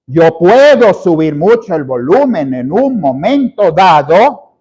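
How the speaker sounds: "subir mucho el volumen" punched word by word in rolling swells. Shown as a demonstration. Coach demonstrates adding volume, hammering "puedo", "volumen" and "dado". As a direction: stress each word of "en un momento dado" with a swooping rise and fall. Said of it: "casi que remarqué las palabras".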